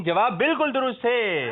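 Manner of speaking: high, slow, ringing proclamation; triumphant announcement. Real, not acted. Host declares the contestant's answer correct, triumphant. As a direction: stretch the verdict out high for applause.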